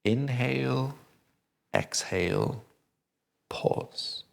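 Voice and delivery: counting out the triangle breath for students; breathing cue demo. Shown as a demonstration. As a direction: model the breath rhythm with your voice. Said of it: "this is our triangle rhythm".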